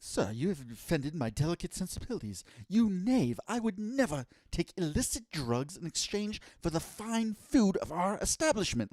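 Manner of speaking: high, affronted prim British worker; hammed-up offended British propriety; prim British accent. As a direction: put on a very proper British accent, scandalised. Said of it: "very prim and proper and having a British accent".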